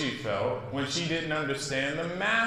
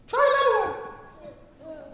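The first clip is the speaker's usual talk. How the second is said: very high, slow fairy voice; slower than usual. Acted. Fairy gently nudging Maya on, high and slow. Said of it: "suggested the fairy".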